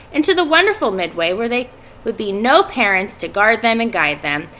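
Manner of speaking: ordinary delivery, nothing marked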